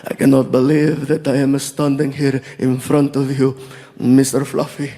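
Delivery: put-on low, choked-up Saudi accent; almost in tears, Arabic accent; deep, moved Saudi fan; emotional Saudi man. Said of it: "one guy was almost in tears".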